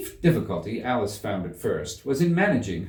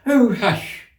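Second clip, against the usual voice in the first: frightened whisper; 12 semitones higher, 7 dB louder than usual. A narrator's reading. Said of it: "whispered in a frightened tone".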